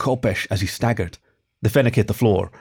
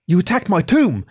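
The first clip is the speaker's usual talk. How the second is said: yelled; 8 semitones higher than usual. Acted. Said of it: "yelled Irsu".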